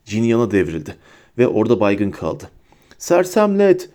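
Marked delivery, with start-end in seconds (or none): 3.0-3.9 high, urgent incantation; mild high-pitched shout; cried-out spell. A narrator's reading. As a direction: call out the spell at a higher pitch. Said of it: "diye haykırdı Neville".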